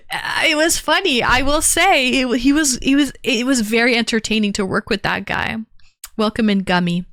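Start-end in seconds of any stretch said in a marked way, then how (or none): none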